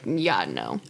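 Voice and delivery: a low, mocking "yeah, no"; mock dismissive reply. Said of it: "You're like".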